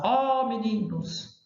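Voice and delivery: long, high "Ó, meninos"; calling interjection. Shown as a demonstration. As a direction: hail the boys with a drawn-out call. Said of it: "ele vem de uma interjeição".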